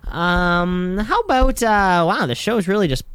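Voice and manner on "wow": ordinary delivery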